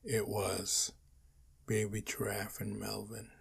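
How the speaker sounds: usual speaking voice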